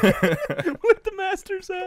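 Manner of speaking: high, slow, hammed-up pompous voice; mock grand entrance. Host mocks a grand entrance, tipping the master's cap. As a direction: play it like a grand gentleman tipping his hat.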